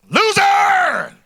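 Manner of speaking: huge high drawn-out shout; mock-triumphant yell; gloating taunt. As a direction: bellow it like an obnoxious winner. Taunting his young son.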